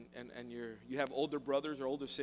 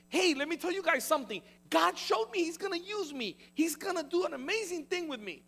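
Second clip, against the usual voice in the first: much higher, eager and bursting with news; excited Joseph; 11 semitones higher, 5 dB louder than usual. Acted. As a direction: blurt it out like a thrilled kid. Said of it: "He was excited".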